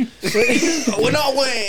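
copying a friend's bad Swedish accent; mock Swedish accent. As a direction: stretch it slowly in a laughing fake Swedish accent. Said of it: "is that Swedish?".

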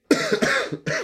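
sudden harsh cough; coughing fit. Real, not acted. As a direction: cough hard mid-sentence. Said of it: "I'm sorry I'm coughing so much".

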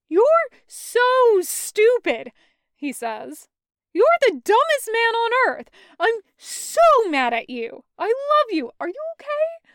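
high, loud, cracking outbursts of relief and scolding; laugh-yell at him, mad and in love at once; loud, high, overwhelmed babble, partly slowed, partly rushed; hysterical relief. Acted. Taco half-laughing, yelling relief.

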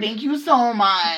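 high, drawn-out imitation of effusive thanks; Gia Gunn-like gushing. Cashier mimicking customer's repeated thanks, over-effusive and drawn out. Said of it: "She was like".

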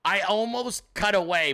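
ordinary talk

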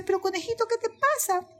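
high, concerned storybook question; puzzled elephant. Acted. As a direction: ask the little rabbit, puzzled. Elephant questions fleeing rabbit. (elefante extrañado, tono agudo)

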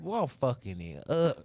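normal talking voice

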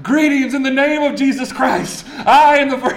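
high, loud, grand missionary announcement; proud grand greeting. Retold or imitated. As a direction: proclaim it like a conquering hero arriving. Proudly greeting village as first missionary.

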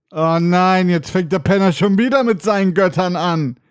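whining mock groan of a fed-up listener; exasperated listener. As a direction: heckle yourself as your annoyed audience. Mimics an annoyed listener interrupting.

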